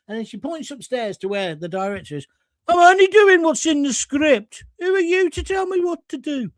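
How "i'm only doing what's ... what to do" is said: much higher Brummie cleaning lady voice; indignant high Brummie protest; Brummie cleaner. Retold or imitated. Cleaner defending herself to floor manager, high Brummie voice. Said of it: "Amy Turtle was the Brummie cleaner".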